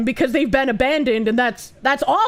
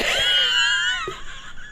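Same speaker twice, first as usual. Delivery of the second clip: high shrieking laugh, can't hold it in; burst of laughter; 4 dB quieter than usual. Real, not acted.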